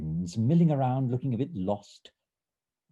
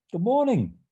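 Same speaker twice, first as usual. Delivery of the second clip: bright high cheery greeting, louder; 11 semitones higher, 6 dB louder than usual. A narrator's reading. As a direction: call out a cheerful hello to a patient.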